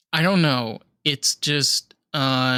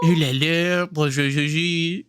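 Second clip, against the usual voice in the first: mock French accent; 4 semitones higher, slower than usual. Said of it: "French is hard".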